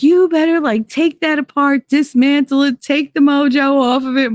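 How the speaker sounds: high, insistent imitation of a spooked ex; hammed-up frantic demand; panicked demand. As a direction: order it undone in a mock panic. Acting ex demanding doll be dismantled.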